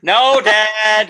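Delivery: fed-up son snapping back; annoyed teen. Retold or imitated. Son's irritated reply.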